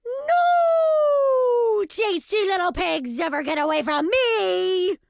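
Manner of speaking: high, long wailing shout; outraged wolf howl. A narrator's reading. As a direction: wail it out as a thwarted wolf. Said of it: "he howled".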